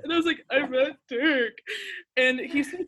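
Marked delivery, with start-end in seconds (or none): 0.5-1.5 happy crying. Retold or imitated. Repeating to dad.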